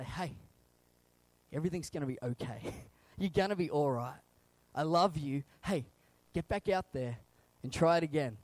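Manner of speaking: low, tender reassurance; comforting. Acted. God comforting us like a parent, tender reassurance. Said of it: "comforts us, and say".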